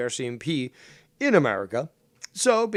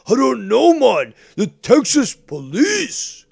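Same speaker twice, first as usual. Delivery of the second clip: high, slow, dopey drawl of "The Texas police"; dumb mocking voice; 9 semitones higher, 8 dB louder, slower than usual. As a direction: play him as a clueless fool, dragging it out. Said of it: "Be like".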